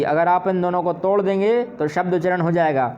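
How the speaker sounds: usual speaking voice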